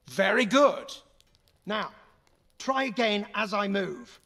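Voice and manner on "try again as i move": normal talking voice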